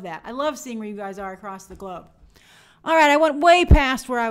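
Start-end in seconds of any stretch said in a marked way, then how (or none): 2.8-4.3 sudden loud outburst. Real, not acted. Laughing at running over time.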